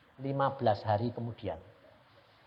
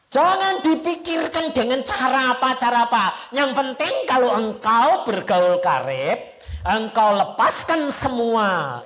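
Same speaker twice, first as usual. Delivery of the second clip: forceful preaching; 10 semitones higher, 8 dB louder than usual. Acted. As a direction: drive the point home to the congregation.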